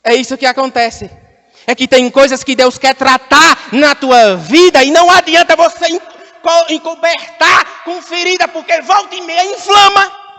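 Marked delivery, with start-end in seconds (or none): 1.7-10.3 rising, high-pitched preaching; pregação inflamada. Real, not acted. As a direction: build urgency toward the last word. Prega com força. (pregação que cresce em intensidade)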